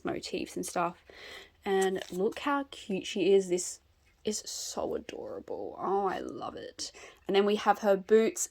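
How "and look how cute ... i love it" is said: soft breathy whisper of delight; whispered adoring. Real, not acted. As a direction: whisper it like she's precious. Cooing over the doll.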